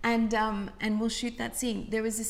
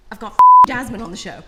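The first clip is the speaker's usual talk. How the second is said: excited announcing; 4 semitones higher, 19 dB louder, faster than usual. Retold or imitated. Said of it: "and he's like".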